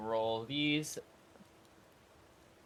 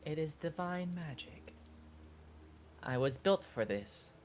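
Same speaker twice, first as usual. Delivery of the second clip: hushed construct; 6 semitones higher, 7 dB quieter than usual. Acted. Construct explains its magic.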